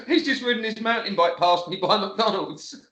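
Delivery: a real laugh running through the words. Real, not acted. Friend retells the bike sighting to the host, laughing. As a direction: let the laughter spill into the line.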